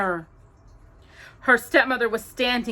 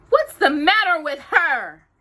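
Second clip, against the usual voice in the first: high, loud irritated question; irritable; 9 semitones higher, 8 dB louder than usual. Acted. Anastasia annoyed at dreamy Cinderella, irritable. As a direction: snap it out, annoyed. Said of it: "Anastasia said irritably".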